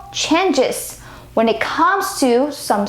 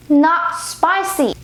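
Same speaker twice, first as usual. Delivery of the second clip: ordinary delivery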